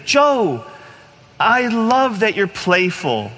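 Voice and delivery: voice of the Lord. Retold or imitated. The Lord telling Joe in the field he loves him, slow and tender. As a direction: speak it slowly as God's gentle reassurance. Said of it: "I heard the Lord say very clearly".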